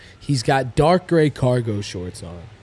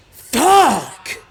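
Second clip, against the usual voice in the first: high, loud one-word outburst; frustrated curse; 9 semitones higher, 8 dB louder than usual. Retold or imitated. Guy cursing after hearing his friend isn't there, loud burst. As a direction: spit it out like he just missed his friend. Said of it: "So he goes".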